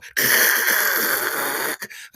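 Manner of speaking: long, loud voiceless rasp; harsh drawn-out throat scrape; ripping noise. Retold or imitated. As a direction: imitate cloth tearing. Imitating the pocket tearing.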